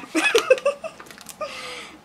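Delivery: long high laughing fit. Real, not acted. Wrapping up her goals to viewers, long high laugh.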